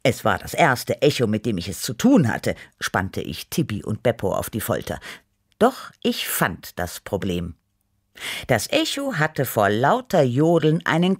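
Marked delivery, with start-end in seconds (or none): none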